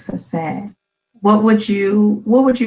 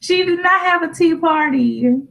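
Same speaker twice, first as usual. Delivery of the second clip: mock disbelief; 8 semitones higher than usual. Mother jokingly corrects child's silly answer.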